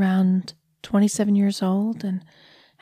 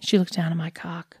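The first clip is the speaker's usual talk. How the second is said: usual speaking voice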